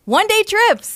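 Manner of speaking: high and loud, like a show title; big announcer hype. Shown as a demonstration. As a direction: announce it like a trailer. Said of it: "Say it again".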